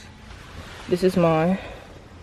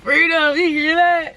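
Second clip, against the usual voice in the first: calling out; 7 semitones higher, 6 dB louder, slower than usual. Real, not acted. Calling friend, disgusted.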